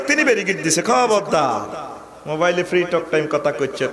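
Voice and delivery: ordinary delivery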